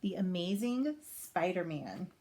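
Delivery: usual speaking voice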